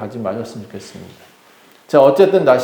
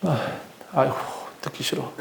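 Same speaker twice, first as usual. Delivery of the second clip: very soft, sighed complaint; weary grumble; 13 dB quieter, faster than usual. Acted. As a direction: grumble under your breath. Acted weary reaction to the shouting. (한숨 섞인 작은 투덜거림)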